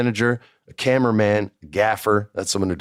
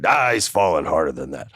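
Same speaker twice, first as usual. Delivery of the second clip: lower, offhand boss voice; dismissive boss; 4 semitones lower than usual. Retold or imitated. Vince shrugging off the stunt danger, low and dismissive. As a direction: wave the worry away like the big boss. Boss brushing off a safety worry.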